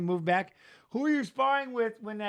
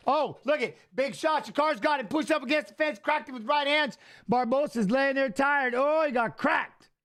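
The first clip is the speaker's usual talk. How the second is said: excited; 7 semitones higher than usual. Real, not acted. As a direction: call the knockdown like you can't believe it. Big shot during the fight.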